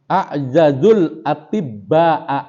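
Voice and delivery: slow, measured reading of Arabic text; kitab recitation. Acted. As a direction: intone each phrase slowly like reading a kitab aloud.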